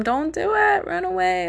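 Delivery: alarmed advice, pitched high; urgent warning. Real, not acted.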